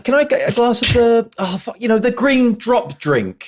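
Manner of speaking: high-pitched, fumbling mock bar order; flustered bar customer. Customer asking a bartender for a forgotten drink, flustered and high. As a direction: squeak out a half-remembered drink order, slowing at the end. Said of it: "I'll be like".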